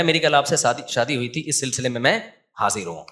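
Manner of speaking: a groom overly polite, fading low and soft; bow and scrape through the words; stiff, excessive courtesy fading away. Retold or imitated. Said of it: "وہ تمیز والی جو ہے نا".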